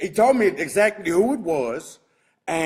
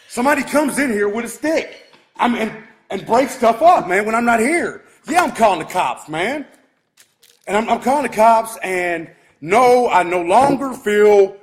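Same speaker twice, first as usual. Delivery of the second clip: louder, angry, driving on; heated rant; 4 semitones higher, 6 dB louder than usual. Real, not acted. Owner ranting to viewers that he's calling the cops, heated and loud. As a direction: pile up the anger as he vows to call the cops.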